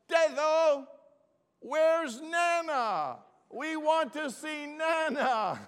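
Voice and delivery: put-on very high, slow, drawn-out child voice; imitated small grandchildren. Grandchildren asking grandfather for Nana, in an imitated child voice. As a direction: whine out a loud, high kids' demand. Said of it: "ran past me and then said".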